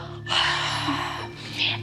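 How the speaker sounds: constricted throat whisper exhale; ocean throat breathing. Shown as a demonstration. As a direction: fog a mirror with your breath. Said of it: "So you might go".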